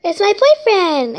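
high loud slow reveal; dramatic. Acted. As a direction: announce it grandly.

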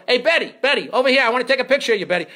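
high, eager calling out; yelling photographer. Retold or imitated. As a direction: hail a star for a picture. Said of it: "ad-libbing and yelling at them".

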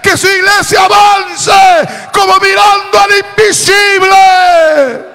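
building to a high, loud climax; predicación a plena voz. Real, not acted. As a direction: drive phrase after phrase up to a preacher's shout. Clímax del sermón. (clímax ferviente, subiendo hasta el grito)

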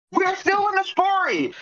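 exasperated protest. Real, not acted. Player objecting to the table that play goes on, exasperated protest. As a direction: protest in a high whine.

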